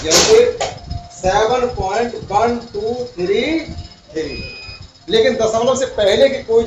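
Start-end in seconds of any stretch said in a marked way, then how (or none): 1.1-4.5 slow, each digit after the point spoken separately; careful teacher's demo of the right way. Shown as a demonstration. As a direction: read one two three three one at a time, slowly. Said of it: "वो सब पढ़ेंगे आप एक एक करके".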